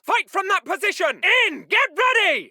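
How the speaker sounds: high, pressing shout; urgent shouted order. Acted. Officer hurrying chaps inside the building, shouting urgently. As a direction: shout them into cover.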